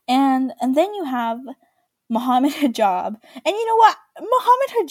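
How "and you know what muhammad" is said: excited burst. Real, not acted. Gossipy rant, sudden jump.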